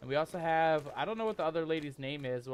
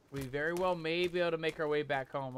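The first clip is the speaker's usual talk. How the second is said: ordinary delivery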